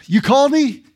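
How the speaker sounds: put-on the boy runs in again, keen to serve; eager little boy.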